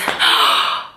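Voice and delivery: sharp startled gasp; mock gasp. Nurse checking the baby's head, sharp gasp. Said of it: "She pulls her finger out and says".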